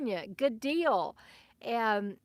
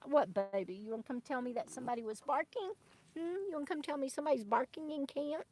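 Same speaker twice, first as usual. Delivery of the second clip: hushed, fast, doting words to a pet; soft talk to the dog; 7 dB quieter, faster than usual. Real, not acted. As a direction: coo quietly at the dog. Talking softly to her dog.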